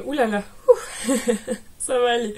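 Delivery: breaks into a real laugh mid-sentence; giggling, then reassuring herself it's fine. Real, not acted. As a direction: laugh through a small mishap. Mishap on camera, laughing.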